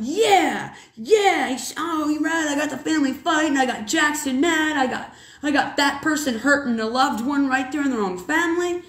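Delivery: a mild hint of the smug devil bragging about his work. Mom to her son, mimicking the devil gloating, lightly.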